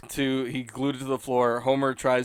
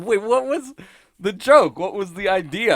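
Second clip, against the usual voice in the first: normal talking voice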